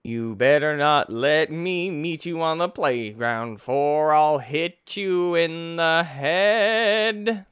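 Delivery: slow, drawn-out playful threat; mock threat. Player to map maker about the playground, mock-threatening. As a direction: stretch the words out into a mock menace.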